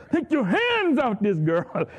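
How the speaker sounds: loud, slow, commanding rebuke; with authority. Retold or imitated. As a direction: order the devil off her. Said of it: "spoke with authority".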